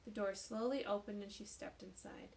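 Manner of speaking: plain reading voice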